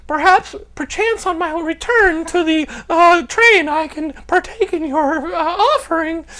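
mock-formal reply to a street hooker, hammed up; overly polite southern gentleman. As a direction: decline like a stiff old-fashioned gentleman. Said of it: "I used my southern, like, manners".

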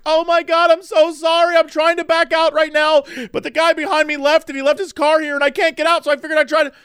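high-pitched frantic apologizing; panicked apology. Retold or imitated. Flustered driver apologizing to the car's owner, imitated in a high panicked voice.

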